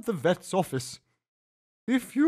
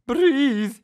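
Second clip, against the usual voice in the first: urging; 9 semitones higher, 8 dB louder than usual. A narrator's reading. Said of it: "he urged".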